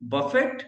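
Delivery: बफेट said with a sounded final t; spelled-out reading. Shown as a demonstration. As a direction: read बफेट as written, t at the end. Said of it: "इस word को आप बोलेंगे". (बफेट को लिखे अनुसार अंत में ट के साथ पढ़ना)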